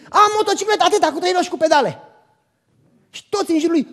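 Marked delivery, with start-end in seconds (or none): 0.1-2.0 put-on louder, higher boast to the other kids; excited kid yelling. Son bragging to the kids outside, excited yelling. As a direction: shout it proudly across the yard. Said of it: "a început să țipe la toți copiii".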